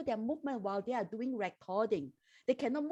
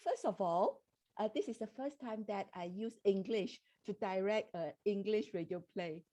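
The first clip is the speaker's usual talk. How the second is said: softly, under usual speaking volume; soft-spoken; 6 dB quieter than usual. Real, not acted. Director answering the host's question about challenges, soft-spoken. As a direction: speak softly, too quiet for the mic. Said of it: "you're a bit soft".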